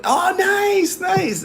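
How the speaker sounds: high-pitched, excited approval; delighted. Real, not acted. Reacting to a friend's karaoke message, high and delighted. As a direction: cheer it, pitch leaping right up.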